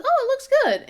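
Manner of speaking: high, loud burst of delight; a kid suddenly loving the shirt. Retold or imitated. Daughter tries on shirt, delighted.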